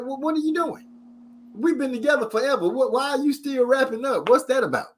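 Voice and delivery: high-pitched baffled protest; mock incredulous. Husband to wife wrapping up in towel, incredulous.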